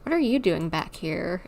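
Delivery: ordinary delivery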